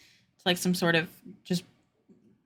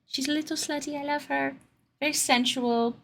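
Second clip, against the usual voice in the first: higher, softer British character voice; British accent; 6 semitones higher, 6 dB quieter than usual. Shown as a demonstration. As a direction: put on a light British accent, pitched up. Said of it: "She's got a bit of a British accent".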